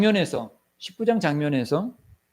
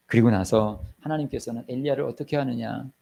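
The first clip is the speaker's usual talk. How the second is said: normal talking voice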